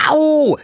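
very high, loud ouch; pained yelp. Acted. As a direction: yelp as if suddenly bitten. Bitten by the plant. (lauter, hoher Schmerzensschrei)

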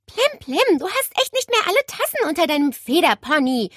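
high, loud, rapid telling-off; indignant outburst that rushes along; scolding. A narrator's reading. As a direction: scold the bird in exasperation. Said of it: "schimpfte Nele".

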